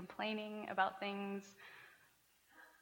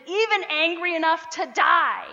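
sharp, loud, higher snapped yes; angry retort; 6 semitones higher, 12 dB louder than usual. Retold or imitated. Jonah retorting to God, mock angry snap. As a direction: bite back at God. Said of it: "Jonah retorted".

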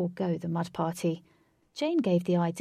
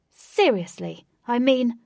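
loud protest; 5 semitones higher, 9 dB louder, slower than usual. Acted. Pelted with mud.

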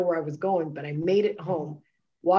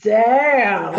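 loud, high, awestruck damn; a loud, startled damn; amazed damn; 5 semitones higher, 11 dB louder than usual. Retold or imitated. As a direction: blurt it out like you just saw yourself new. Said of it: "I was like".

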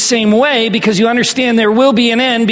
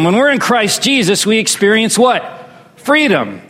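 ordinary talk, nothing marked

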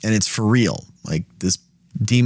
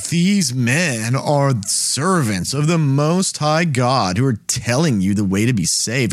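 mock them, drawing the words out; sarcastic mocking; 2 semitones higher, slower than usual. Shown as a demonstration. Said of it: "She'd be doing it very sarcastically".

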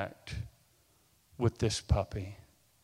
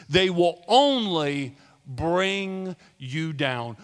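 ordinary talk, nothing marked

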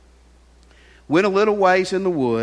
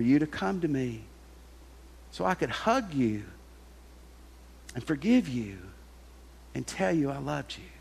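soft, gentle forgiving voice, hushed at the end; tender grandfather; 2 semitones lower, 4 dB quieter than usual. Acted. Granddaddy softly forgiving the boy who killed the duck, tender. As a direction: comfort him as you forgive.

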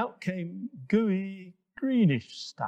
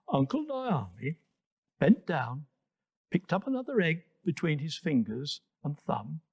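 ordinary delivery

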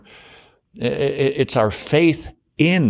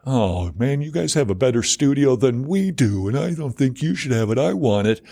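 jealous whine. Acted. Acted jealous covetous inner voice.

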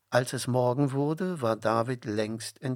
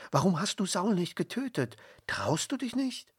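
outraged friends, higher and pushing faster; indignant; 9 semitones higher, faster than usual. A narrator's reading. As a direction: indignantly challenge him, pitch climbing. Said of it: "Aber seine Freunde waren empört".

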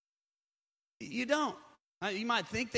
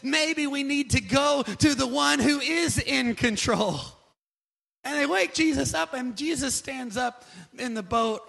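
raised, urgent pulpit voice; full-force preaching; 6 semitones higher, 7 dB louder than usual. Real, not acted. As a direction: preach it high and loud, stretching the key words.

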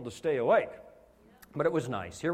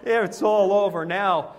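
mock despair; 3 semitones higher, 6 dB louder than usual. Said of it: "I said".

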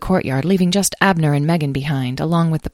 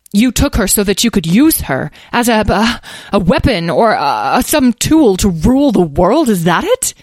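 heated, higher-pitched outburst; angry accusation; 5 semitones higher, 5 dB louder than usual. Acted. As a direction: confront them, halting as you hunt for words. Mother angrily accuses child's captors.

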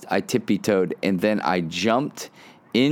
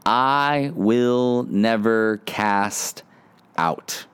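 slow emphatic; much slower than usual. Shown as a demonstration.

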